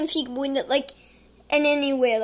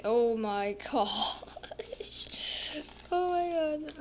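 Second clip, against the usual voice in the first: laughing whisper; 2 semitones higher, 5 dB quieter, much slower than usual. Real, not acted. Reacting to gross candy.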